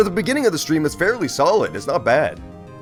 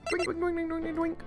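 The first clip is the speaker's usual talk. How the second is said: sound effect imitation; 10 dB quieter than usual.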